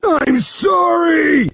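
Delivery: high, drawn-out shouted apology; loud, strained forced apology; pained yell. Acted. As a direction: yell it in agony under a hold. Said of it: "he yells".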